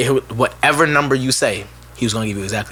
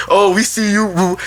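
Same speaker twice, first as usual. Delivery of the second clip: slow, sing-song taunt; playful slow taunt; teasing classmates; 5 semitones higher, slower than usual. Retold or imitated. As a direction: drag it out mockingly.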